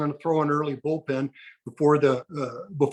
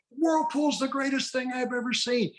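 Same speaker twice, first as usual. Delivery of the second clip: mock gushing; 8 semitones higher than usual. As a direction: imitate a delighted old manager raving, up high. Tommy's repeated gushing answer.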